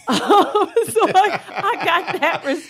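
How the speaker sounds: high, slow laugh through the words. Real, not acted. Mother laughing while telling the host about the name. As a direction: laugh as you admit it.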